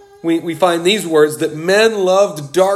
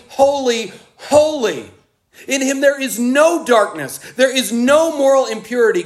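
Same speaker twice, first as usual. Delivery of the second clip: higher, rising fervour, holy holy holy ringing out; preaching at full force; 6 semitones higher than usual. Acted. Preacher to congregation on God's holiness, fervent and high. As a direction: proclaim God's holiness, lifting the voice.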